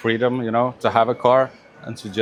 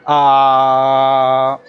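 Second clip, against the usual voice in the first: long steady "uh", projected; louder sustained vowel; 5 dB louder than usual. Shown as a demonstration. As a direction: hold the vowel out loud and even. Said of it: "yeah, but a bit louder".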